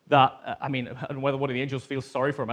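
normal talking voice

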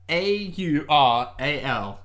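A U R A L spelled letter by letter, slowly. Shown as a demonstration. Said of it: "Relating to the ear".

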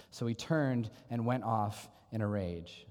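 usual speaking voice

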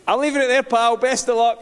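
high, loud cheeky comeback; defiant retort. Retold or imitated. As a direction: call it back up with cocky defiance. Defiant reply to shouting neighbour.

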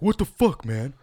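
imitating a shocked drunk's yell; outraged yell. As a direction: yell it like he's just been slapped. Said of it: "And he's like".

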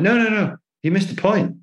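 high, impatient no no no; irritated correction. Retold or imitated. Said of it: "And he went".

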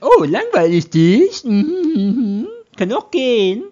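high, loud and slow, huffy and hurt; sulky offended voice. Pretend. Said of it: "Die korrekte Antwort ist also nicht".

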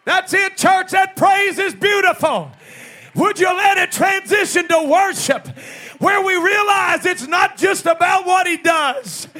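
shouted, high revival sermon; preacher shouting to rouse the congregation; full-force preaching. Real, not acted. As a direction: proclaim it in ringing phrases, stretching the last words.

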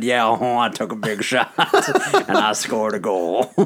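light shy hockey player voice; lightly voiced shy player, half laughing; Chris Butler voice. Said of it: "That's our Chris Butler voice".

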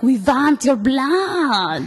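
slow Dracula-style vampire with a mock Russian flavor; dressed as vampires at the blood drive, slow and campy. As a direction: drag it out like a hammed-up count. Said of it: "um, vampire".

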